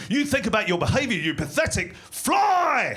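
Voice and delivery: hammed-up outrage; mock angry scolding. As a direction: tell it off, stretching the last word into a shout. Scolding a fly he tortures.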